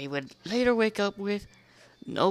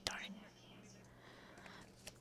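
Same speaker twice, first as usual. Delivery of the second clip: muttered aside; 29 dB quieter than usual. Real, not acted. Muttered aside while reading.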